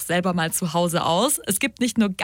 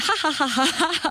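long rising ha-ha laugh on the out-breath; demo laugh; 7 semitones higher than usual. Shown as a demonstration. Showing listeners the laugh-yoga exhale, laughing freely. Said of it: "und beim Ausatmen dann".

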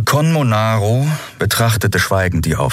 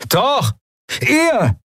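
high, sharp outcry; angry retort. A narrator's reading. Bearded man blames the captain, angry shout. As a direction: throw the blame straight back.

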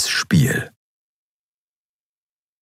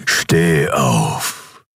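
low, distorted emperor's command; 4 semitones lower than usual. A narrator's reading. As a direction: command through a distorted voice effect. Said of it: "tönte die verzerrte Stimme des Kaisers". (verzerrte Kaiserstimme)

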